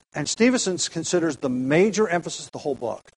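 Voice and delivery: ordinary talk, nothing marked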